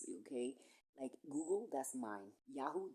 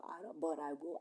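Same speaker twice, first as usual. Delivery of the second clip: normal talking voice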